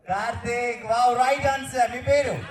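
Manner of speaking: high, loud, thrilled shout of a right answer; excited. Real, not acted. Host cheering a guest's right answer, excited call. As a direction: cheer it like the host just won.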